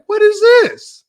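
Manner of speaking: squeaky high, loud "what is this"; mock disbelief. Host reacting to Dreamers Pro giggling, in high, loud mock disbelief. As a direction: yelp it in put-on shock. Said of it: "I'm like".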